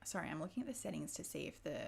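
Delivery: ordinary delivery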